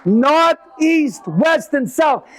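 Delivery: booming mock announcement. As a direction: call them out like a herald. Comic opening, loud announcing.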